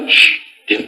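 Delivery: put-on whispered hush to a child; parent hushing. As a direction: hush the kid in a breathy whisper.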